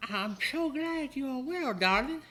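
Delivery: put-on sweet high older woman; Calypso voice. GM voicing Calypso.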